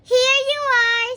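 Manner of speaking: high, loud and bright; Mister Happy giving the box; eager offer. A narrator's reading. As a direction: hand it over with delight. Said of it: "said Mister Happy".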